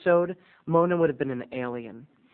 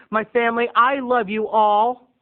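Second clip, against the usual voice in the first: hammed-up declaration; 6 semitones higher, 6 dB louder than usual. Host apologizing to family on air.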